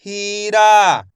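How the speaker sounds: हीरा with both vowels held; stretched pronunciation. Shown as a demonstration. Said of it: "मैं इसका खींच के उच्चारण कर रहा हूँ".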